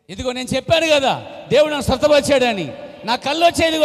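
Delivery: high, loud cry of triumph; triumphant shout. Retold or imitated. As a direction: shout it out, sight finally restored. Sight restored, triumphant cry. (కళ్ళు వచ్చిన ఆనందంతో విజయ అరుపు)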